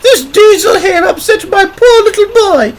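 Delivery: very high, loud female voice; indignant mum reporting in a piercing falsetto; complaining mother. A narrator's reading. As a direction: explain it loudly, high and aggrieved. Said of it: "she explained".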